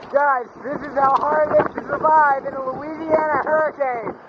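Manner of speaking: high, strained shout over the wind. Real, not acted. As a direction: yell above the storm. Filming in the hurricane wind.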